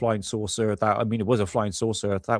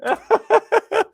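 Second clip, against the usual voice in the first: loud, high-pitched laugh at himself; high burst of laughter; 6 dB louder than usual. Real, not acted. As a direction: crack up loudly at the mix-up. Laughs at his own confusion.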